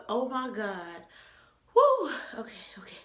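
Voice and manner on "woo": loud, high yelp of disgust; loud whoop. Real, not acted. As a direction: yelp at the taste.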